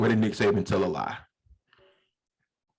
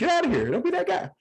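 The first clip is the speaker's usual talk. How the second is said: much higher, louder mock crowd jeer; 4 dB louder than usual. Deli crowd mocking the tourist, high-pitched. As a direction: jeer like a fed-up deli line. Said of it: "and everybody's like".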